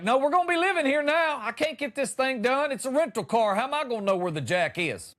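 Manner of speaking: hammed-up flustered rant; mock panic. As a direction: play yourself panicking over a flat. Panicking man at flat tire.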